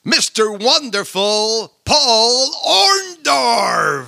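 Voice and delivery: booming, slow ring announcer call; arena announcer. Acted. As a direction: stretch the name out like a ring announcer. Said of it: "Essaye donc de le présenter".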